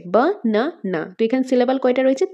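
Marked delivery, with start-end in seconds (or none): 0.1-1.1 banana very slowly in three parts, বা, না, না; বানানা as three drawn-out, separate beats; syllable by syllable. Shown as a demonstration. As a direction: pause after each syllable of banana. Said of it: "তিনটা part হচ্ছে".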